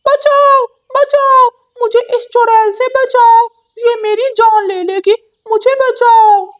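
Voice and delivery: very high, loud panicked cries; screaming for help. Acted. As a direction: shriek for help as if beaten. Parrot being beaten.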